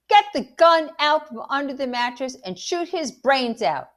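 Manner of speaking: loud, high, furious tirade. A narrator's reading. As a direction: rant orders at full anger. Angry mother orders dog shot.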